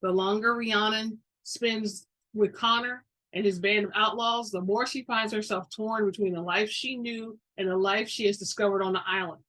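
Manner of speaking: ordinary delivery, nothing marked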